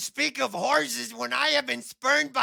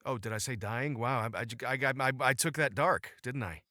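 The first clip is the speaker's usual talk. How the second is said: deep character voice; 11 semitones lower, 4 dB quieter than usual. Acted.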